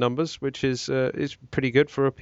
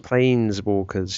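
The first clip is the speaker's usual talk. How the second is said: "Planeswalkers" stretched out, slow and deliberate; drawn out slowly; 4 semitones lower, slower than usual. Real, not acted. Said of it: "Shouldn't you, shouldn't you say that a bit quicker?".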